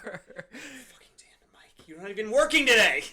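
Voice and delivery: furious whispered curse; whispered exasperation at Mike; angry whisper. Acted. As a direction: hiss it at Mike under your breath. Cursing Mike under breath.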